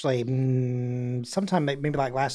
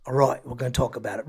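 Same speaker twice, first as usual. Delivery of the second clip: mock London accent; 4 semitones lower than usual. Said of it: "he's like".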